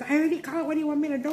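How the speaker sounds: mock annoyed daughter. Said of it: "she was like".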